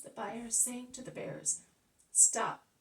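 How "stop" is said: loud sharp halt; command. Acted. Jesus to the bearers, loud command. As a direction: stop them dead.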